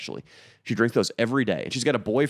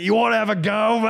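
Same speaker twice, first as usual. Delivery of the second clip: high, loud mock challenge; picking a fight; 11 semitones higher, 5 dB louder than usual. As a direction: square up in a hammed-up English voice. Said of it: "then he's like".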